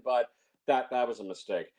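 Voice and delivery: ordinary talk, nothing marked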